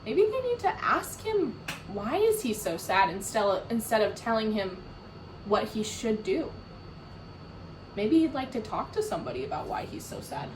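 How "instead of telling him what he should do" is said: ordinary delivery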